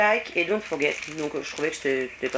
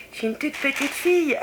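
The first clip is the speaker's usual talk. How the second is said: put-on high-pitched little-girl voice, about to giggle; jokey confession in a raised childlike voice; playful little girl; 7 semitones higher than usual. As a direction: pipe up like a small child, mock-childish. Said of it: "je suis une vraie enfant".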